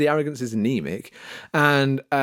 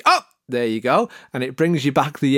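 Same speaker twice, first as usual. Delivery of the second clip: usual speaking voice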